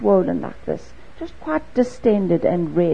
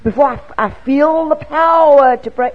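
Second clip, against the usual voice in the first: mock fussy believer; 4 semitones higher, 5 dB louder than usual. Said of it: "I didn't say".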